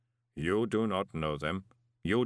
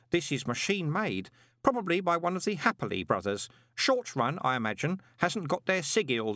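put-on high-pitched, absorbed young enthusiast; earnest boy pin expert; 8 semitones higher than usual. A narrator's reading. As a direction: lecture eagerly in a high boy's voice.

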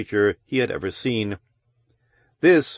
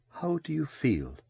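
much softer, husky woman's voice; a quiet husky throb; low husky voice; 4 semitones higher, 10 dB quieter than usual. A narrator's reading. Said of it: "Her voice was low and husky".

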